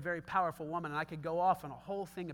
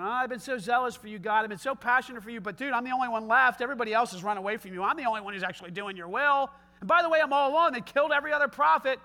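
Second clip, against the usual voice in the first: complaining self-pity, pitched up and louder; whiny Elijah; 4 semitones higher, 7 dB louder, faster than usual. Acted. Elijah complaining to God about being alone, whiny. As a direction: play Elijah moaning about being the last one.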